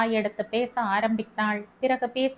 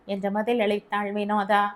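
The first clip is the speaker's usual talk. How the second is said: plain reading voice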